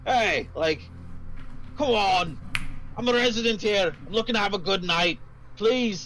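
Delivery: put-on mildly slurred drunk plea; drunk patron. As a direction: beg like a tipsy regular who wants his night. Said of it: "slurring his words".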